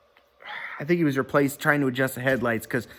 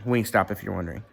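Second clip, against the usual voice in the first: ordinary talk, nothing marked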